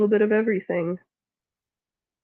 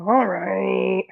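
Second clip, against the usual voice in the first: ordinary delivery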